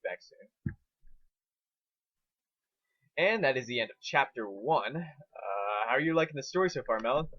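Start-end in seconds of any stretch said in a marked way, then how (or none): none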